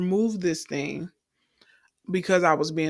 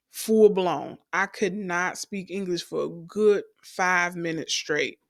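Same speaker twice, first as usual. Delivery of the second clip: ordinary talk, nothing marked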